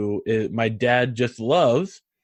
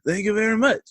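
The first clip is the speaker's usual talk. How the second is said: higher-pitched imitation of a grateful musician; imitated musician; 8 semitones higher, faster than usual. Retelling swamp musician thanking a fan, imitated. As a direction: lightly mimic the singer's thanks. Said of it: "And he's like".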